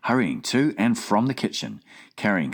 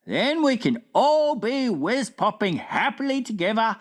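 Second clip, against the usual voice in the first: BFG voice; 12 semitones higher than usual. Acted. Giant asking the Queen at breakfast, wondering. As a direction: puzzle it out like a big gentle giant.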